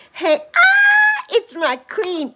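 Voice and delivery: high, loud squeal of delight; light up as a friend arrives; thrilled hey to a friend; excited greeting. Real, not acted. Greeting a friend joining live.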